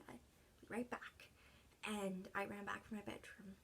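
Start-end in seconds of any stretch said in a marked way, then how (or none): 0.1-1.3 fading whisper. Retold or imitated. Whispered promise to dying father.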